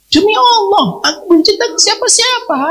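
high, pleading insistence; a retold wife's urgent vow; earnest oath. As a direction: swear it's true, voice up high. Wife swears she told no one.

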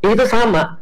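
ordinary talk, nothing marked

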